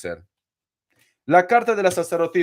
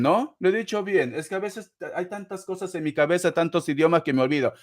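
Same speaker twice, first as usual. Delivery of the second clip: ordinary talk, nothing marked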